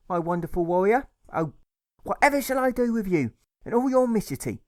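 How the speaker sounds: very high, loud, theatrical mum; hammed-up doting mother. A narrator's reading. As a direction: gush over him, over the top. Mother voiced, gushing over son.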